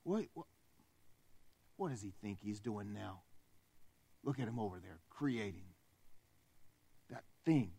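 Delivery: a soft, muttered double take, low; spy on it and scoff quietly; lightly voiced jealous devil; hushed disbelief. Satan notices God creating man.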